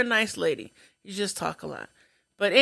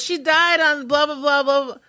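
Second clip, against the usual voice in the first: high, loud mock reporting; loud announcement; 6 semitones higher, 5 dB louder than usual. Patient telling everybody the answer, loud high imitation. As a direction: announce it to everyone, gabbled filler.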